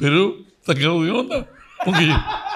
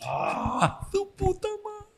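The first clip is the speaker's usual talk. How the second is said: much higher, squealing outburst of shock; shocked shriek; 8 dB quieter, much slower than usual. Real, not acted. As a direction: shriek it like you can't believe it. Shocked at motel invitation.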